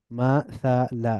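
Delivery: মাসালা slowly as ma, tha, la; syllable by syllable. Shown as a demonstration. Teacher breaking down the word, মাসালা syllable by syllable. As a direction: split মাসালা into three drawn-out syllables. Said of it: "একটু মিলিয়ে মিলিয়ে পড়ব".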